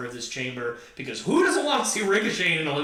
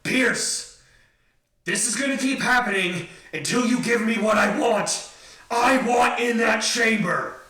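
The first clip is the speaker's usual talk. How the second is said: Drew McIntyre demand; 6 semitones higher, 5 dB louder than usual. Retold or imitated. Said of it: "I'm not gonna try to do the big Scottish accent".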